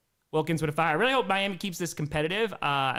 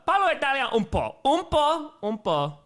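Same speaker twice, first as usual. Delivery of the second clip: proud Italian accent, higher; 9 semitones higher, 5 dB louder than usual. Shown as a demonstration. Answering chat praise, Italian accent. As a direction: speak it with an Italian lilt. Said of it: "Great Italian accent".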